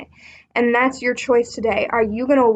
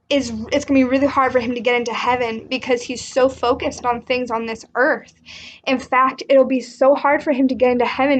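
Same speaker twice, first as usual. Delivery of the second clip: ordinary talk, nothing marked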